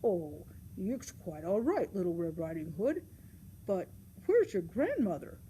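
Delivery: low, hearty woodcutter voice; deep storybook rescuer, unhurried at first. Acted. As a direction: play a kindly woodsman, pitched low. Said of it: "the Woodcutter said".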